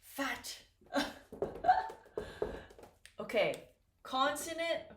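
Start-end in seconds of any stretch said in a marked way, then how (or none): 0.9-1.9 short breathy laugh, pitched high; real laugh. Real, not acted. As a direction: laugh at your own joke. Teacher laughs at own joke.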